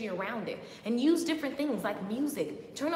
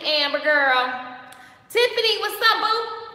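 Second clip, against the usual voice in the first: excited greeting; 6 semitones higher, 8 dB louder, slower than usual. Real, not acted. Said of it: "greets live chat viewers".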